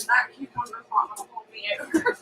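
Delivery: fast whispered aside; hungry whispered grumble. Real, not acted. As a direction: whisper it to yourself, quickly. Aside about being hungry.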